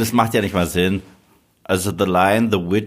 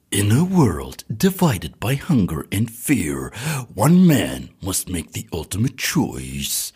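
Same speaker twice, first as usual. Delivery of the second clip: American movie trailer voice; slower than usual. Shown as a demonstration. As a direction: announce it like an eighties blockbuster trailer. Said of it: "das ist die amerikanische".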